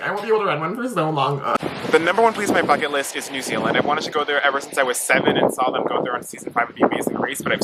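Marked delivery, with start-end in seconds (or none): 0.0-1.4 high mock-sad whine about yourself; self-pitying imitation, pitched up. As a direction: play up feeling sorry for yourself. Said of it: "just, like, making myself sad".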